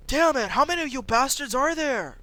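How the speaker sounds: high, loud furious outburst; angry curse. Acted. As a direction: rage at the pursuers. Elliot surrounded.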